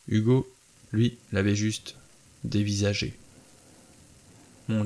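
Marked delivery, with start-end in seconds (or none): none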